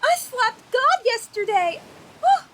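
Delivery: aghast at her own sin; horrified realization. Acted. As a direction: spell out the awful realization. Acolyte realizes her blunder.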